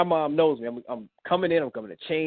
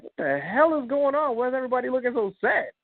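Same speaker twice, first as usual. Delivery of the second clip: alarmed; 10 semitones higher than usual. Retold or imitated. Walking into a sad room.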